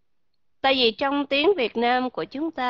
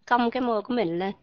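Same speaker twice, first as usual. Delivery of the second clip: usual speaking voice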